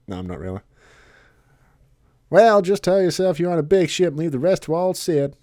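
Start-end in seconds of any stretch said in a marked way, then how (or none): 2.3-5.3 a hint of a gruff pilot; louder, breezy old captain; lightly voiced old Sid. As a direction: pilot telling the group to relax. Voices Cid in a Let's Play.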